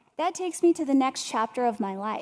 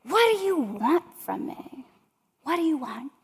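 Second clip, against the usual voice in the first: retold angry yell, higher and louder; reliving mom's frustrated outburst, slowed; retold scream at daughter; 5 semitones higher, 4 dB louder than usual. Daughter retelling mom's fight, mock scream. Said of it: "and she screams at me".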